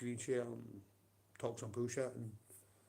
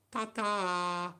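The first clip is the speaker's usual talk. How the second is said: mocking goodbye; 8 semitones higher, 8 dB louder than usual. Real, not acted. Mocking threat to rival.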